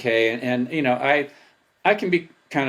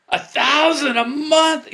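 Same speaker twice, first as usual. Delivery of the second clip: a much higher, slow, stretched disbelieving squeak; astonished; 5 dB louder, slower than usual. Retold or imitated. Athlete hearing his first sponsorship offer, high and slow in astonishment. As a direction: let the surprise burst out in spite of yourself. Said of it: "I tried to hide my enthusiasm".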